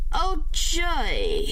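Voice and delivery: whispered sarcastic oh joy. Real, not acted. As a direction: breathe it out, deadpan and sarcastic. Sarcastic whisper after bad news.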